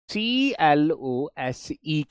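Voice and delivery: सीएलओएसई spelled letter by letter, slowly; spelling out letters. Shown as a demonstration. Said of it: "इन सबको क्या बोलते हैं".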